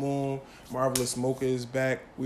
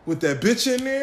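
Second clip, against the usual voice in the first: put-on quoted girlfriend; 7 semitones higher, 3 dB louder than usual. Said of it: "She be like".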